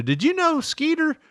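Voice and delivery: very high chatty mother voice; mom's voice. Retold or imitated. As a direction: nag like a chatty old mom. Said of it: "my mom always starts off with".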